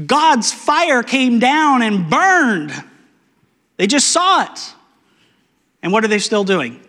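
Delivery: ordinary talk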